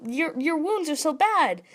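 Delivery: lightly voiced, fretting over a hurt friend; worried Armin. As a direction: Armin alarmed at Sasha's wounds. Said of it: "and he's like".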